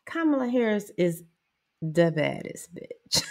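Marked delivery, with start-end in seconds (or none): none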